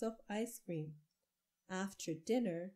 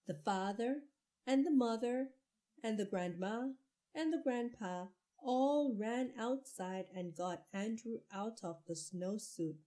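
plain reading voice

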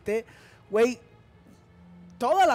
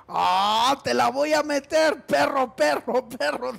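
loud mock troll taunt, slower; 4 dB louder than usual.

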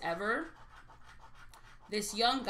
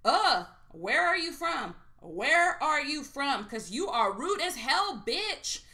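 loud, slow mock confrontation, pitched higher; mimicking a rude man; 5 semitones higher, 6 dB louder, slower than usual. Retelling the rude stranger at her table, loud and slow. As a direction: throw his rude question back at her.

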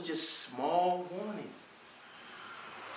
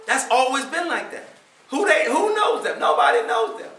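rising, louder, scoffing voice of the doubters; mock scornful doubters; 5 semitones higher, 9 dB louder than usual. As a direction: scoff at them, climbing higher and louder. Mocking the doubters' words.

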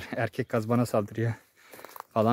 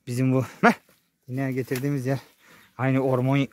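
ordinary delivery

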